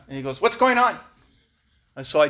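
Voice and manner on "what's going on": high, loud, blunt phone voice; imitated tough guy on the phone; Tomas impression. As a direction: demand it loudly like a tough sponsor. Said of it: "That's how he talks".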